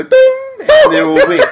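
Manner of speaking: high, loud game-show ding; mock ding. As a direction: ring it out like a quiz buzzer.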